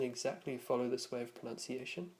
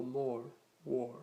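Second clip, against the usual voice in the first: North American "more, war" with the r sounded; North American accent. Shown as a demonstration. Teacher recapping North American vowels, American r in "more, war". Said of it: "North American style usage".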